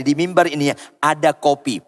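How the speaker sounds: usual speaking voice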